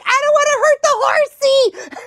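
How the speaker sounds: put-on very high childlike wail; mock panicked whine. Scared rider answering her friend, high mock-panicked wail. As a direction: plead in comic distress.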